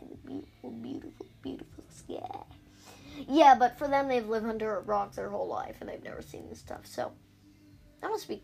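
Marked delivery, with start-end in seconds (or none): 0.1-2.4 soft, awed whisper fading out; dreamy whisper. Pretend. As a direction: breathe the words like a dream. Dreamy whisper about the sky.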